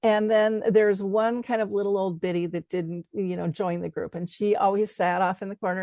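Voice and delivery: ordinary talk, nothing marked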